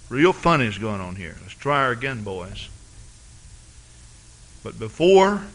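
ordinary delivery

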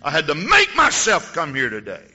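loud, high retold shout. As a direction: shout it out, desperate to leave. Colleen shouts she wants out.

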